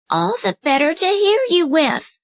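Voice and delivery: high put-on old lady voice; the wolf sweetly pretending to be grandmother; wolf in disguise imitating grandmother; wolf faking granny.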